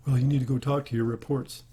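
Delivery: a light send-up of a corporate boss; mock manager voice. Scorning managers who call people reports, mock boss voice. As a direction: parrot the boss's jargon with mild disdain.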